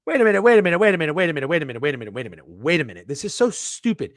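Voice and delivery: loud rapid-fire protest, falling away, then rising again; upset. Real, not acted. Bettor objecting to a repeat pitching matchup, upset. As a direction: object in exasperation, words tumbling out. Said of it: "I'm sorry that you're upset".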